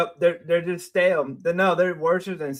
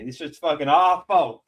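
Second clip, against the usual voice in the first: usual speaking voice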